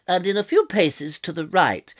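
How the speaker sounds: posh, projected BBC reading; plummy BBC announcer accent. Pretend. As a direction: say it in old-fashioned BBC English. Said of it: "lost in this accent".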